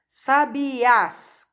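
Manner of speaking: "Sabiás" with a strong final syllable; tônica na última sílaba. Shown as a demonstration. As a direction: land firmly on the end of "Sabiás". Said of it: "É a última sílaba da palavra sabiás". ("Sabiás" com força na última sílaba)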